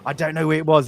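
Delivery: usual speaking voice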